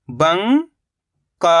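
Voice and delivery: drawn out very slowly, sound by sound; slowly stretched syllable. Shown as a demonstration. Teacher to pupils, sounding out a word very slowly. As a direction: stretch each sound for the class. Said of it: "मिलके बन जाएगा bunker".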